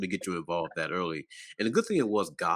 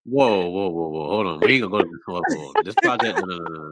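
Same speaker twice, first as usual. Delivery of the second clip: louder, laughing stop, whoa whoa; loud playful interruption; 7 dB louder than usual. Real, not acted. Host halting the guest's story, loud laughing protest.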